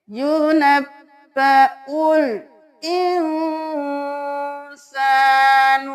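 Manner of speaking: slow, melodic tajweed chant of یونبئ الانسان; Quran recitation. Shown as a demonstration. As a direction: chant it slowly, tajweed style. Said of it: "تو ہم کیا پڑھیں گے". (آہستہ تجوید کے ساتھ تلاوت)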